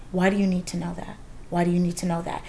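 a hint of a patient therapist, low and soft; calm therapist voice. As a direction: lightly voice the therapist's quiet, probing question. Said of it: "my therapist would ask me".

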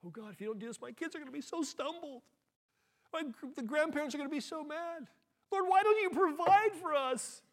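high, pleading, rising to a loud cry; desperate prayer climbing into a cry to God; trembling prayer. Retold or imitated. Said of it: "I was trembling".

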